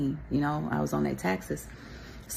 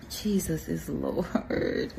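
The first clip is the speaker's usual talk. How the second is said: slow murmur; much slower than usual. Real, not acted. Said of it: "calming herself mid-story".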